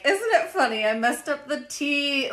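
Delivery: put-on high, slow old radio lady; 1940s radio voice. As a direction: sound like a forties radio show. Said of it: "they're talking about".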